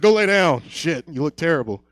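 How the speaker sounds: lightly voiced mom, loud opening order; scolding mom. Mom sends her stoned son to bed, mock scolding. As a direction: order your son off firmly, mock-motherly.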